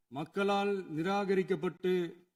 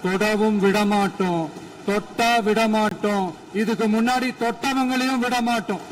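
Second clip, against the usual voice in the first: real raised-voice political pledge; defiant vow; 5 dB louder than usual. Real, not acted.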